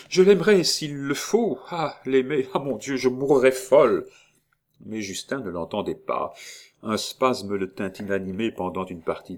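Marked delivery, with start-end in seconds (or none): 0.1-4.1 higher, louder frantic vow; distraught outburst. A narrator's reading. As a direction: rave in desperate emotion. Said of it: "avec une émotion désordonnée". (aveu éperdu, plus aigu et plus fort)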